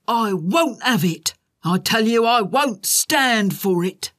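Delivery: pompous boss fuming; crying out angrily. A narrator's reading. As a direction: hammer each word slowly in outrage. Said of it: "he cried".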